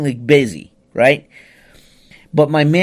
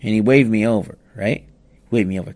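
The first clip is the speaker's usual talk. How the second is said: normal talking voice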